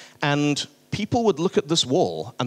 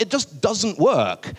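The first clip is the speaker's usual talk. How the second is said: whiny caller; 7 semitones higher, slower than usual. Retold or imitated. Caller vaguely complains about outage.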